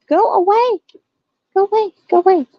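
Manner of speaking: short high-pitched shoos; repeated shooing. Acted. Owner chasing cat from the trap room, high repeated shooing.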